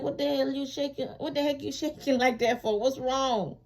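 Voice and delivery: high, laughing incredulous questions; quizzing her shaking lawyer, half laughing; exasperated disbelief. Retold or imitated. To her trembling attorney, high amused disbelief. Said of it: "I'm like".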